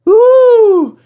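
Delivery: very high loud squealing coaches; high pitched outraged squeal; coaches scream. A narrator's reading. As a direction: shriek like offended little coaches. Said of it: "screamed Agnes".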